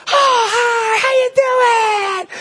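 high, slow, gushing sing-song; gushing cashier voice. Retold or imitated. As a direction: melt over the baby like a charmed cashier. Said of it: "And she goes".

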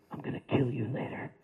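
low and quiet, a hulking jock's threat; big guy threat. Retold or imitated. Football player Bones threatening the narrator, low and soft.

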